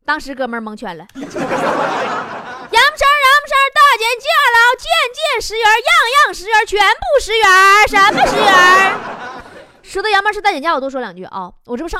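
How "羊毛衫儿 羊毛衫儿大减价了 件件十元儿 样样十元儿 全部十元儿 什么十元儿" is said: much faster, higher and louder, like a street sale barker; a hawker's fast, loud sales chant; rapid-fire patter. Shown as a demonstration. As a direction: rattle the 件件 样样 全部十元儿 line off at top speed. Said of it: "repeats the test question fast".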